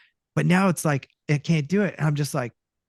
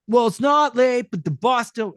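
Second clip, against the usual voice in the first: cartoony ad read; 9 semitones higher, 6 dB louder than usual. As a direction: belt it out like an old cartoon host, loud. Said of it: "I could hear it go".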